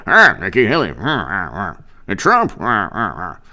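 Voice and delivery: low grunts round muttered names; demonstrated grunts. Shown as a demonstration. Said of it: "I'm gonna do a grunt".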